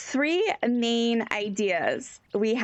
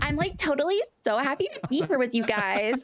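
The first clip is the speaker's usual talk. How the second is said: put-on higher-pitched Valley girl accent; 4 semitones higher than usual. Parroting the bandmate as a Cali Valley girl, in Valley-girl voice. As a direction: gush like a California Valley girl. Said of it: "Cali Valley girl".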